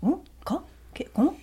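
high, hushed French bewilderment; baffled French visitors. Acted. French visitors to a museum worker, mock bafflement. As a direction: imitate French people not understanding, nearly whispered. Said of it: "they're just like".

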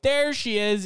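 the welcome they did not give; big cheer. Shown as a demonstration. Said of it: "I guess it wasn't like".

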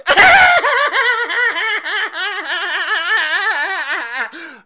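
very long, loud, high mock cackle; Kamala Harris cackle. Host parodying a politician's laugh, manic cackle. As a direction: burst into an overblown endless cackle. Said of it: "renditions of Kamala Harris's speech patterns".

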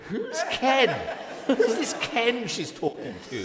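high, laughing posh voice; mock-baffled Oxford student, very high; puzzled English student. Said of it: "And they're all looking at me going".

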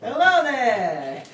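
squeaky helium-high voice; sucked helium, chipmunk pitch. Real, not acted. Helium voice greeting.

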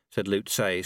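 ordinary delivery, nothing marked

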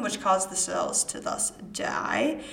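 ordinary talk, nothing marked

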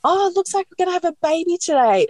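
very high, quick sing-out; imitated cheery midwife. Georgia arriving to the labouring woman, imitated high cheery greeting. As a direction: mimic an upbeat announcement, pitched right up.

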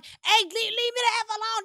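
mock yell. Wife snapping at her husband with a mock yell. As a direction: shout them off, fed up.

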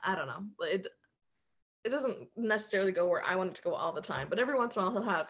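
ordinary talk, nothing marked

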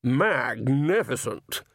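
slow strained croak, winded. Acted. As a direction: croak it out after a knee blow. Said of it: "he croaked as he folded up".

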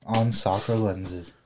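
slow, hammed-up promo voice; podcast ad read. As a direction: sell it like a cheesy announcer. Mock podcast plug read.

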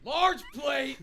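high, loud practice shout; scream. Shown as a demonstration. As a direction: yell it like a kitchen call to scare people. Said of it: "I'm gonna scream".

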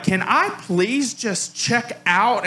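fed-up shopper pleading at the till; exasperated plea. Retold or imitated. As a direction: beg to be let go, worn out. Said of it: "I've lost it".

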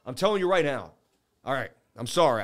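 usual speaking voice

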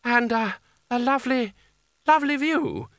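very high, halting, nervous voice; Creosote. A narrator's reading. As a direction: stammer it out in a high pitch. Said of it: "said Creosote, his voice lost in the slipstream".

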